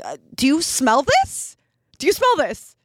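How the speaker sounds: incredulous, swooping question; insistent asking. Retold or imitated. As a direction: press them for an answer, pitch climbing. Retold urgent asking boyfriend.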